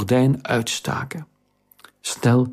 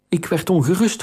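lightly voiced worried boy; 6 semitones higher than usual. Boy voices worry to girl.

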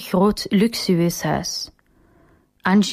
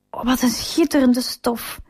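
delighted; 4 semitones higher than usual. A narrator's reading. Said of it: "helemaal blij als hij de soutane ziet".

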